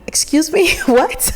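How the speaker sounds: high, laughing, baffled excuse me; confused excuse me. Retold or imitated. Student abroad retelling her confusion, laughing and baffled. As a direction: ask back as if lost, laughing at yourself. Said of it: "I would literally be like".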